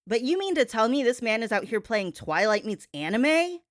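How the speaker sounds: ordinary talk, nothing marked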